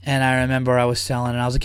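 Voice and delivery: ordinary talk, nothing marked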